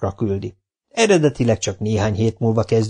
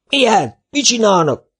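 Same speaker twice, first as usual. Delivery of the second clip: very high, loud alarmed yell; 12 semitones higher, 4 dB louder than usual. Acted. Foreman yelling at the smoking fires, very high and loud. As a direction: scream it at the smoke. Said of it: "üvöltötte a főépítésvezető".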